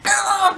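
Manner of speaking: mock pained groan; retold groan of dismay. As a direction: groan as if pinned under something. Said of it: "I was just, like".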